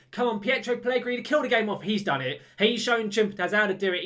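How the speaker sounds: real thrill at the goal that kills the game; urgent excited call. Real, not acted. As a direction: push him on, then celebrate. Urging on a counter-attack goal.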